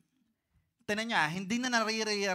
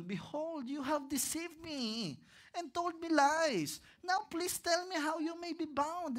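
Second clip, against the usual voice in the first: much higher, slow, sulky pouting complaint; whiny Delilah; 7 semitones higher, slower than usual. Acted. Delilah sulks at Samson for lying, high whiny pout. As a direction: whine like a spoiled sweetheart. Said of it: "Pabebe si Delilah".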